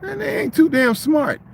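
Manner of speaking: high, slow scornful aside; retold scoff. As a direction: drag it out high and mocking.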